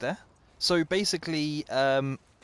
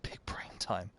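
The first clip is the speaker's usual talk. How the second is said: lower, softer sneaky whisper; 7 semitones lower, 10 dB quieter, slower than usual. Real, not acted. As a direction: whisper it like a secret plan. Sneaking quietly in game.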